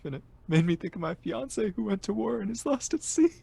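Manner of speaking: sniffling student overcome by the song; emotional outburst. Acted. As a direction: spill the tragic story. Tearful confession about fiancé.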